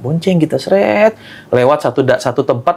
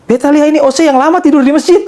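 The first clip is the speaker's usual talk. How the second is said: put-on higher, louder Ambon old man voice; amused old man in an Ambon accent, pitched up; 11 semitones higher, 3 dB louder than usual. Old man teasing the narrator, mock Ambon accent.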